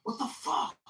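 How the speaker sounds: high, squeaky mock outrage; a high put-on voice cursing in shock; Tyrone's angry outburst. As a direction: shriek it like an insulted worker. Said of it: "And he said".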